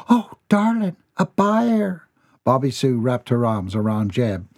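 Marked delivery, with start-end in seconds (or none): 0.1-2.0 much higher thrilled wife; a wife gushing over good news; high delighted wife's voice. A narrator's reading. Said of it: "wife delighted by news".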